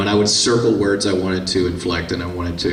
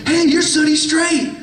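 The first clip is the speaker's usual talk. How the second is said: much higher, slow thrilled call; excited fan. Retold or imitated. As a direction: call out like you spotted a star. Stranger recognizes him in store.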